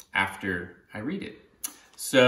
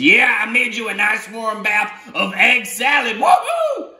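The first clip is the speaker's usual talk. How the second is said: high, loud gleeful cheer; excited octopus; 9 semitones higher, 4 dB louder than usual. Acted. Octopus proudly revealing the egg-salad bath, high whoop. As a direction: burst into a whoop at the end. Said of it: "the octopus says".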